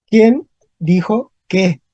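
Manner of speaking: slow, separated "¿Quién ... dijo ... qué?"; dictating "¿Quién dijo qué?" slowly to a class; word by word. Shown as a demonstration. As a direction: leave a pause after "Quién" and after "dijo". Teacher repeats example word by word.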